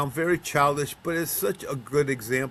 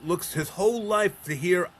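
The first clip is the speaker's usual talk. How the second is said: ordinary talk, nothing marked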